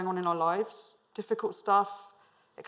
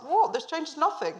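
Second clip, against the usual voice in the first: surprised boss; 8 semitones higher, 4 dB louder than usual. Retold or imitated. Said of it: "he was like".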